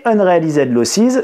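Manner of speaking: "Unrealized losses" repeated slowly in a light English accent; English accent again. Shown as a demonstration. Teacher repeating the English term for the class, light English accent. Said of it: "Vous apprécierez mon accent anglais".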